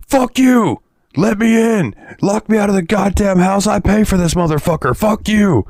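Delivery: high-pitched imitation of an angry yell; retold yelling. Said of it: "he's yelling, like".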